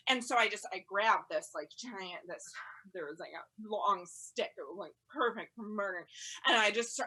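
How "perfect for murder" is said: ordinary talk, nothing marked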